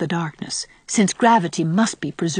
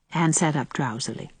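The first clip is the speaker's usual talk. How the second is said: usual speaking voice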